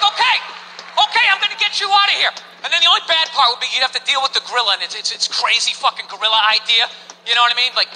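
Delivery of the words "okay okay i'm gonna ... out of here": high, quick promise; urgent reassurance. Acted. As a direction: promise rescue urgently, pitched up. Imagined rescuer promising escape.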